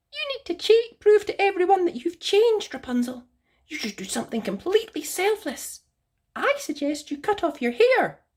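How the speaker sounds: very high, prim bird voice; Sylvia the bird. Acted. Haughty bird correcting Rapunzel, very high voice. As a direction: squeak it out like a haughty little bird.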